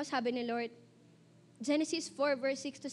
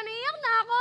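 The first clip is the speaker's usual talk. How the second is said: high, louder mock near-crying; tearful whine; 6 semitones higher, 6 dB louder than usual. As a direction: imitate being on the verge of tears. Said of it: "nagjo-joke pa rin ako".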